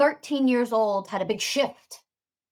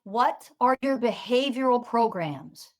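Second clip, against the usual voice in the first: usual speaking voice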